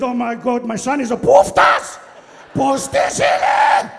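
horrified father bellowing, words stretched out; appalled dad. Acted. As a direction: build to a loud, drawn-out outburst. Appalled Greek dad in a story.

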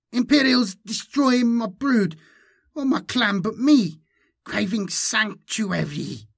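high, loud alien creature; high, forceful twisted-tongued hybrid; Malindi, inhuman voice. A narrator's reading. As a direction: plead loudly through a distorted larynx. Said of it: "her voice was far from human".

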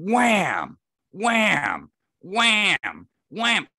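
loud, high, drawn-out whams; booming knock imitation. Storyteller imitating powerful knocks on a tree, loud and booming. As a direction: hit each wham hard, like a blow on a tree.

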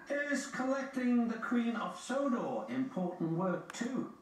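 high, eager engine voice; James the engine. A narrator's reading. James asking the Fat Controller about work, keen. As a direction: ask it high-pitched and keen.

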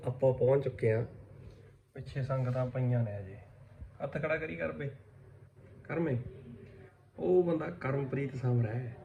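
hushed voice; quiet. Real, not acted. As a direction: keep it low and soft. ਕਾਕਾ ਸਰਪੰਚ ਜੀ ਕੂਕ ਸੁੱਤੇ.

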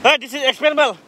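ordinary delivery

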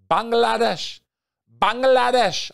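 Bangladesh in a high, loud, sing-song lilt falling at the end; savoring the name. Shown as a demonstration. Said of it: "my favorite country to say its name".

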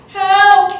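loud, high scream for help; screamed cry for help. A narrator's reading. Trapped boy screams into the storm for help, loud high cry. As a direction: call out high and hard for rescue. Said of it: "he screamed".

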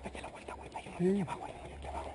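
urgent whisper near the enemy. Real, not acted. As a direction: breathe the warning so they can't hear. Hiding near enemy, warning partner. (susurro urgente)

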